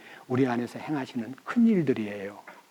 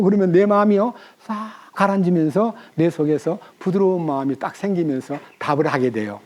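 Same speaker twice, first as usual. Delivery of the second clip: ordinary delivery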